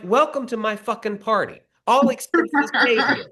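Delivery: high, hammed-up party host voice; sarcastic mock host. Writer mocking the director as yacht host, high sarcastic voice. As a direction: play the gracious host with biting sarcasm. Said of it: "I was so mad".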